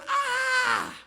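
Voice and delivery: very high, loud pained cry; sudden mock shriek of agony; scream of pain. Father struck by migraine, screaming in pain. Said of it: "papanya langsung migrain".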